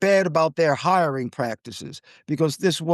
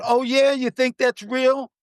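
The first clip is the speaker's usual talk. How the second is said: higher, sneering challenge; mock the attendant's snippy comeback; scornful retort; 7 semitones higher than usual. Flight attendant snaps back at the wife, scornful and high.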